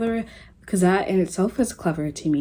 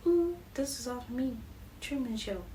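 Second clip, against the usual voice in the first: smug royalty; 5 semitones higher, 11 dB quieter than usual. Retold or imitated. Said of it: "Feeling like".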